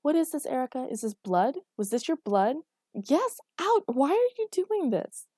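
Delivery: high, loud, frantic questioning; loud, agitated outburst that climbs in pitch; alarmed confrontation. A narrator's reading. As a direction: demand answers in rising alarm. Alarmed, angry confrontation over blood.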